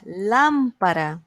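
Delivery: slow "Lámpara", LÁM held long; stress on LÁM. Shown as a demonstration. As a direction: lean on the first syllable of "Lámpara". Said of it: "Antepenúltima".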